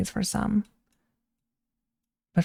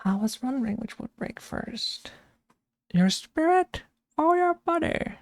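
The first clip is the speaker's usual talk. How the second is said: much softer then leaping much higher on "your spirit or your body"; exaggerated Bane voice; 8 semitones higher, 3 dB quieter than usual. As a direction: ham up Bane's strange lilt. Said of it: "He has such a weird voice".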